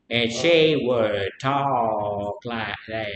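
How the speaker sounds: drawn-out, slow and low, like cousin Kitty; slow Alabama talk. Retold or imitated. Teacher imitating his slow-talking cousin to the class, lightly drawled. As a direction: stretch every word out lazily. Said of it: "they speak very slowly".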